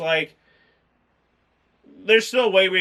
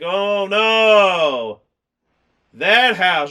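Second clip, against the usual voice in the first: loud hammed-up gasp of dread; mock horror; 2 semitones higher, 7 dB louder, much slower than usual. Reacting to the movie for co-host, hammed-up alarm. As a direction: play up the fake shock. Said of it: "And I'm like".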